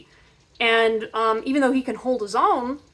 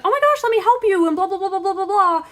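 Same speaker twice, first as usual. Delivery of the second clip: much higher, louder gushing do-gooder; eager helper; 9 semitones higher, 5 dB louder than usual. Retold or imitated. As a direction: gush like a pushy helper. Overeager helper voiced.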